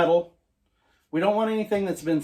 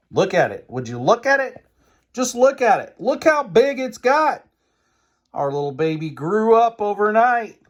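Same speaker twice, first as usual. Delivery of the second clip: excited; 6 semitones higher, 6 dB louder than usual. Real, not acted. Proudly showing the risen dough.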